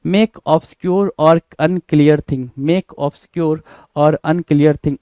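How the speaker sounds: each word spaced out, very slow and deliberate; slow dictation. Shown as a demonstration. Teacher dictating a meaning to the class, very slow. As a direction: dictate slowly so students can write it down.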